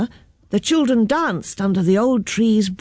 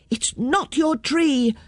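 put-on higher, drawn-out child's retort; children's shout; 6 semitones higher, slower than usual. A narrator's reading. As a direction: throw it back high, like defiant kids. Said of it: "shouted the children".

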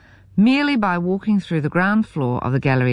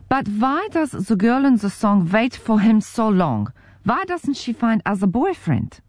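put-on higher, puzzled German accent; lightly voiced German man, puzzled; 5 semitones higher than usual. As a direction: ask innocently in a German accent. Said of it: "his heavenly German accent".

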